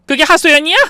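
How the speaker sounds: high, loud incredulous question; outraged disbelief. Acted. Mother disputing son's excuse for phone gossip, outraged. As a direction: fling the word back angrily.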